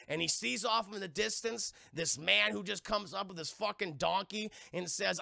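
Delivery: ordinary talk, nothing marked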